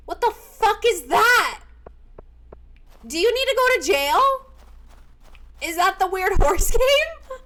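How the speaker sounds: loud, high-pitched incredulous demand; shocked outburst. Real, not acted. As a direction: yell it in scandalized disbelief. Shocked reaction to a viewer's suggestion.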